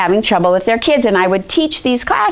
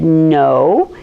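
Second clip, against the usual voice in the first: stern no; 7 semitones lower than usual. Retold or imitated. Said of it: "I looked at him really stern".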